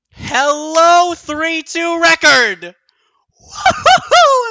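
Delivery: very high, loud victory scream; ecstatic shout. Real, not acted. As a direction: scream the win at the top of your voice. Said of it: "I'm losing my voice".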